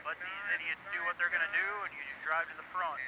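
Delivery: fast clipped radio chatter; urging the driver over the radio; race spotter radio. Real, not acted. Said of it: "that session of Crank It Up".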